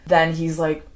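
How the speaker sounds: ordinary delivery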